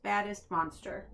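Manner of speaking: ordinary delivery, nothing marked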